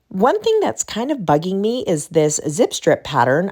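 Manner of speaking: ordinary talk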